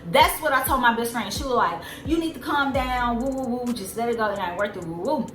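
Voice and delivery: usual speaking voice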